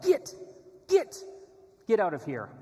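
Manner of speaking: high, loud shout to drive off a dog; imitated yell. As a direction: yell as if chasing away a stray. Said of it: "He starts yelling at the kid".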